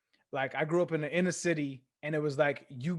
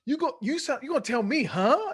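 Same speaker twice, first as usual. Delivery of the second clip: high, loud mock rage building up; animated mock rant; 9 semitones higher, 5 dB louder than usual. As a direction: ham up the coach going off over one word. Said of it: "so animated".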